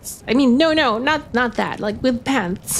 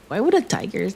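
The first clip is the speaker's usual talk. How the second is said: normal talking voice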